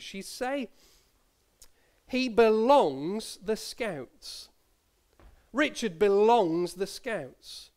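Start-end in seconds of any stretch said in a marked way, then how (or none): 2.1-7.7 slow, drawn-out BELONGS with no gap for a word; granny's quaint phrase. Retold or imitated. Grandchild quoting granny's little phrase, slowly savouring BELONGS. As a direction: stretch BELONGS long and raised, straight into "the Scouts". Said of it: "she used to have this little phrase".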